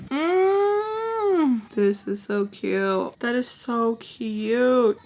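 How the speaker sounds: drawn-out, adoring squeal into slow coo. Real, not acted. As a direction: gush slowly over something adorable. Cooing over something cute.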